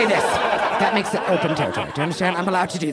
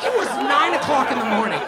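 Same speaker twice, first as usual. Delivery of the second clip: high, indignant exclamation; mock outrage; 8 semitones higher, 4 dB louder than usual. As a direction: protest in a raised, scandalized voice. Outraged reaction to driver's question.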